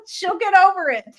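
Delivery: high, giggly brush-off; laughing dismissal. Real, not acted. Fan dismissing the heroine's feelings, laughing. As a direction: wave it away while laughing.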